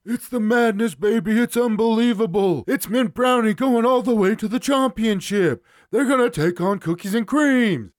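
high, loud, manic hoops announcer yell; Dick Vitale impression. Podcast host hams up Vitale for a protein bar ad, manic shouting. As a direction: scream it like a courtside TV analyst. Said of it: "I can't do a Dick Vitale accent".

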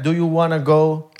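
slow, drawn-out "Do you wanna go?". Shown as a demonstration.

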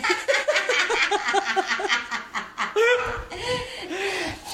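high burst of laughter; long, high-pitched cackling laugh. Real, not acted. Co-host cracks up over the front-door joke, laughing.

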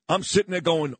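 ordinary talk, nothing marked

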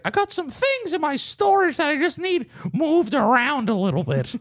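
put-on high, slow old man voice; high-pitched elderly impression, dragging the words; old man neighbor. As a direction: imitate an old man drawing out a request. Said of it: "He's like".

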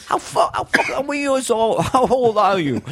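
high, stumbling disbelief; bewildered dad. Acted. Father baffled by his small son, bewildered stammer. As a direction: stammer the question, pitch way up.

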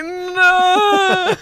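high, loud mock scream; imitating a scream. Imitating her own scream from the slow-mo video. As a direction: replay the frozen scream in slow motion. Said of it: "The slow-mo of me".

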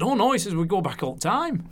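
light Lancashire voice, brushing it off; a touch of northern Lancashire, casual and unbothered; Lancashire accent. Retold or imitated. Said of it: "So it's my Lancashire accent there".

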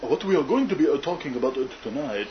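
cartoon voice.

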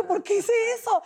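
squealing at what she did; amused disbelief. Real, not acted. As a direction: marvel at her own nerve. Laughing at her own outburst.

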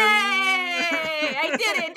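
high, shouted victory cry; excited cheer. Real, not acted. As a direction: cheer like you just won. Guesses the right answer.

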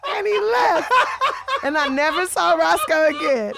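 real laughing. Real, not acted. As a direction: crack up while finishing your story. Cracking up over her own punchline.

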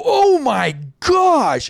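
slow, high, drawn-out oh my gosh; horrified at the injury, slow and high; shocked gasp. Retold or imitated. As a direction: act stunned at the sight. Reacting to the bloody wound.

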